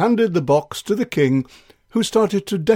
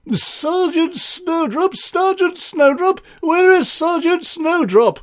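much higher, calling for the sergeant; calling out, king voice. Acted. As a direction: call out anxiously, high-pitched. King calls for his sergeant.

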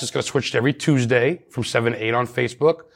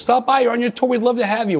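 high, bright invitation in a lightly put-on voice; imitating the tour guide; 10 semitones higher than usual. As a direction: chirp the invite up high, mock-friendly. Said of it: "She goes".

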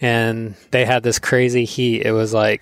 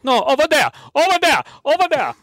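much higher, louder mock Hawaiian pidgin chant; high pidgin chorus; 3 dB louder, faster than usual. More uncles joining the cone fight in high Hawaiian pidgin. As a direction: pile on like a crowd of uncles. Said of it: "more guys like".

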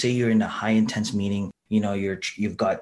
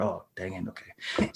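deflated mutter; 2 semitones lower, 9 dB quieter, slower than usual. Retold or imitated. Deflated retold reaction.

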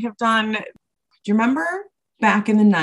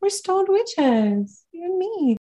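high, slow spooky witch voice; stoner voice; 11 semitones higher, much slower than usual. Pretend. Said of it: "repeats in put-on voice".

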